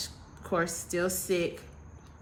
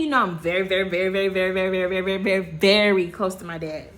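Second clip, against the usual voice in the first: ratchet; 5 dB louder, faster than usual. Shown as a demonstration. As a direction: ham up every very, piling them on. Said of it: "I could've just said it like that".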